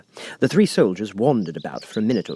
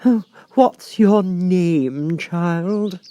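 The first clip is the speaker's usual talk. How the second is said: high, slow, lofty Queen voice; imperious Queen; 10 semitones higher, 5 dB louder, much slower than usual. A narrator's reading. The Queen questioning Alice, lofty and slow. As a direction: look down on the child from the throne. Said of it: "She went on".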